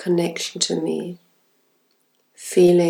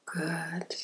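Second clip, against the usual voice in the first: unvoiced soft praise; whisper; 16 dB quieter than usual. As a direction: whisper it gently.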